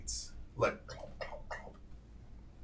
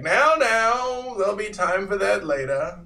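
raised, high-pitched 'now, now' scolding; chiding call; 8 semitones higher, 5 dB louder than usual. Acted. Man with lantern puts off the fun, loudly chiding. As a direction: call them to order from on high.

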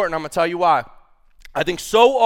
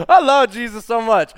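higher and louder, bursting with love; joyful outburst; 6 semitones higher, 9 dB louder than usual. Real, not acted. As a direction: blurt it out with real joy. Sudden joyful outburst about Jesus.

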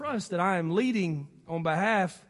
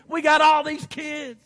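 mock complaining; 6 semitones higher, 5 dB louder, slower than usual. Voices parents' excuse, louder.

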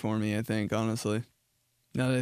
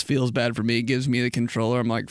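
usual speaking voice

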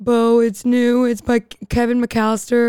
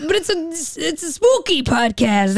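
goblin voice; 4 semitones higher than usual. Pretend. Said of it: "I gotta talk like I'm a".

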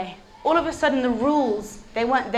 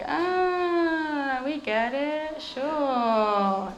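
disciples faking; 3 semitones higher, 4 dB quieter, much slower than usual. Acted. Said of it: "the disciples are like".

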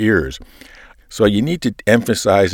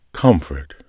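whispered "Comfort.", then a long pause; 2 semitones lower than usual. Shown as a demonstration. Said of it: "starts with the word comfort and just lets that word hang there".

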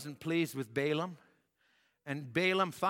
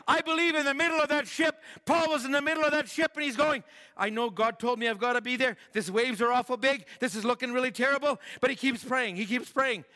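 high and loud storytelling; fervent preaching; 8 semitones higher, 7 dB louder than usual. Real, not acted. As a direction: drive the story with fervor. Preaching at full force.

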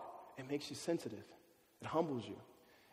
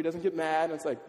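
ordinary delivery

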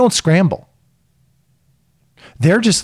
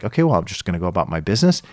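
breezy calm; 6 semitones lower, 4 dB quieter, much faster than usual. Retold or imitated. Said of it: "the new me is like".

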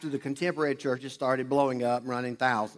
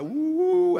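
high, loud mocking woo-woo; mock mystical hoot; 13 semitones higher, 5 dB louder than usual. Pastor mocking the over-spiritual crowd with a high, loud hoot. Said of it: "I'm not one of those, like".

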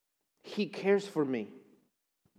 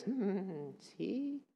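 soft, slow, sneering taunt; the enemy's taunting voice; 4 semitones higher, 7 dB quieter, slower than usual. Acted. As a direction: whisper doubts at them like a sly tempter. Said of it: "I'm using this voice".

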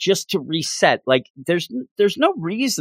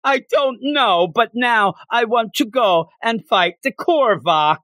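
high, slow, hammed-up Canadian accent; cartoon Canadian, drawn out; mock Canadian accent; 10 semitones higher, slower than usual. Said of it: "That's how I think everybody in Canada talks".

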